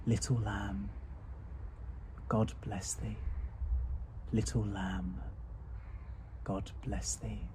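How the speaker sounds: quiet tender farewell; soft blessing. Acted. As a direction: bless the lamb tenderly, almost under breath.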